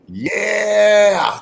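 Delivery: long, high, loud holler; signature yell. Shown as a demonstration. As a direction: stretch it out into a ringing yell. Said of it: "I have to yell it out".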